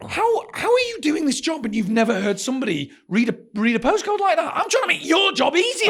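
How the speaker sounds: high-pitched disbelief, rising as it goes; retold exasperated rant. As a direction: plead indignantly with an imagined operator. Ranting at the imagined operator.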